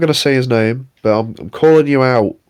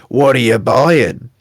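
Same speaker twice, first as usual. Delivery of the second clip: slow, drawn-out shady vendor voice; the merchant; slower than usual. Pretend. Partygoer in costume offering goods in character, slow merchant voice. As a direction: stretch it out like a game trader hawking wares. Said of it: "the merchant from Resident Evil Four".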